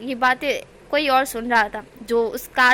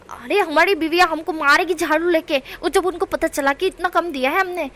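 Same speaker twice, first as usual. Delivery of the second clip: mimicking the customer; 5 semitones higher, 5 dB louder than usual. A narrator's reading. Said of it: "बोलते हैं उसी की भाषा में".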